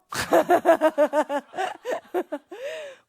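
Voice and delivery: long, loud, high laugh; burst of laughter. Real, not acted. Preacher amused by her own prayer story, laughing loudly.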